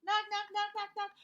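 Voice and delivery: high, loud rhythmic knock-knock; knocking sounds. Acted. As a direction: tap it out like a knock at the door. Acting out knocking at door.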